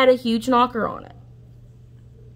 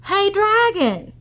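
high, loud, slow yell; loud shout; 11 semitones higher, slower than usual. Acted. Princess yelling into the sleeping dragon's ear. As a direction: yell right into his ear. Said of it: "She shouted as loud as she could".

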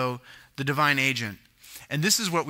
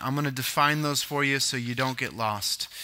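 usual speaking voice

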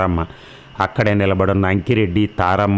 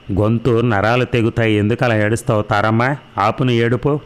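ordinary delivery, nothing marked

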